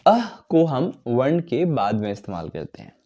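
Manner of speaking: usual speaking voice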